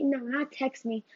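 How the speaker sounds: ordinary talk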